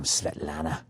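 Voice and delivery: Svetlana dropped low and soft, almost crooned; sultry repeat. Shown as a demonstration. Host repeating the pilot's name to himself, low and soft. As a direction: murmur Svetlana low and dreamy. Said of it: "How great is that name?".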